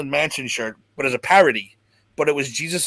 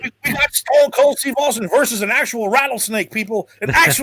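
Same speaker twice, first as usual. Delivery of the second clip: high, excited announcer call; mock announcer excitement, pitched up; 7 semitones higher than usual. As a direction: hype it like a wrestling commentator. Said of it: "So it's like".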